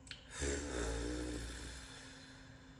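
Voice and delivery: long, low sigh; weary sigh. Real, not acted. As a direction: let out a long, tired sigh. Drained, unwell, pausing mid-plans.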